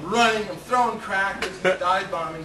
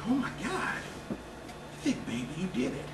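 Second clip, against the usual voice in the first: hushed awe; 3 semitones higher, 10 dB quieter than usual. Retold or imitated. Old guy awed, hushed.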